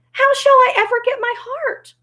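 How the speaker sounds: high-pitched despairing question; dismayed Tin Woodman. Acted. Tin Woodman fears losing his heart, dismayed. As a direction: lament it way up high.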